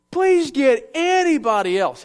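high, slow, pleading; whiny plea. Retold or imitated. Moses pleading with God for a replacement, slow high whine. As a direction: drag out the begging like a reluctant servant. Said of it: "Uh, translation".

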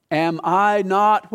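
Am I not drawn out very slowly; slow emphasis. Shown as a demonstration. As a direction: lean on each word of Am I not.

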